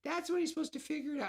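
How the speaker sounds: hammed-up squeaky fan, rushed at the end; mocking fans. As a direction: mimic a fussy nitpicking fan. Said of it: "everyone might be like".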